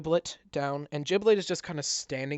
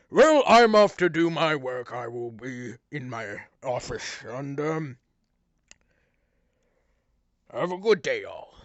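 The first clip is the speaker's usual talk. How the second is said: pompous mayor; 10 dB louder than usual. Acted. Mayor addressing the cheering tavern, pompous and loud. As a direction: play the self-important mayor. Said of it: "and he's like".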